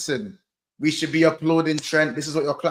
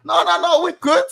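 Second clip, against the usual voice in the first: high, louder schoolkid voice; pitched-up hurried reassurance; schoolkid covering up; 9 semitones higher, 6 dB louder than usual. Retold or imitated. Schoolkid telling the head teacher all is fine, high-pitched and insistent. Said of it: "going to the head teacher".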